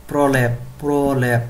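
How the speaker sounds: প্রলেপ said slowly as pro-lep; pronunciation drill. Shown as a demonstration. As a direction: model প্রলেপ twice, drawing out the repeat. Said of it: "উচ্চারণ করতে হবে এইভাবে".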